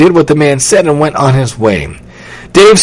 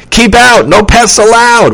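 high, loud warning; forbidding door sign; 8 semitones higher, 4 dB louder than usual. A narrator's reading. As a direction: proclaim it to ward off a sister.

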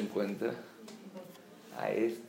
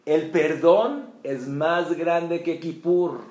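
slow, loud and higher on "el perdón es más grande que Kippur"; preaching emphasis; 6 semitones higher, 11 dB louder, slower than usual. Real, not acted. Rabbi to his congregation, proclaiming slowly and loudly. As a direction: proclaim it as the lesson's climax.

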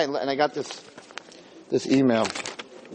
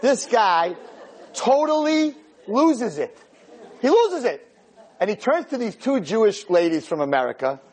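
normal talking voice

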